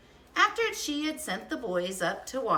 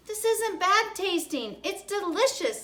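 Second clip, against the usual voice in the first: coaxing mother; 11 semitones higher than usual. Acted. Mother coaxing child with medicine.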